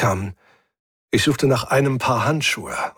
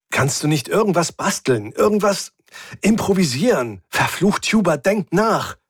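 pitched up, pressing and frustrated; desperate urging; 7 semitones higher than usual. A narrator's reading. Captain begs mechanic to improvise, desperately urging. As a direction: plead and demand, climbing higher.

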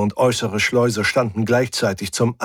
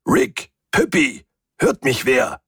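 high-pitched call for anyone listening; calling through steel; 5 semitones higher than usual. A narrator's reading. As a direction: call out from behind a heavy shut door. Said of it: "von zehn Zentimeter Stahl fast bis zur Unhörbarkeit gedämpft". (hoch durch die Tür rufend)

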